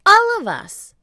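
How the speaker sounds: high, loud plea; insisting shout. Acted. Jack insists to the captain, loud. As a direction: push the point.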